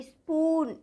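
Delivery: "स्पून" with a long drawn-out "oo"; long U sound. Shown as a demonstration. Teacher's example of long U, slowly stretched स्पून. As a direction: stretch the vowel, "spooon" for स्पून. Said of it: "बड़े ऊ की मात्रा आई है".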